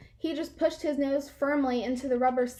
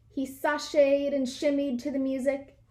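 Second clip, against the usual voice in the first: ordinary delivery, nothing marked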